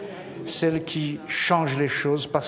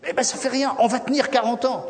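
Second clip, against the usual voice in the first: cheerful defiance; 7 semitones higher than usual. Acted. Caleb undaunted reply.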